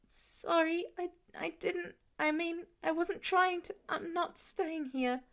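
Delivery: put-on a hurt kid fumbling an excuse; frightened kid. A narrator's reading. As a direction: stumble over the words like a scared boy. Frightened kid voiced in story.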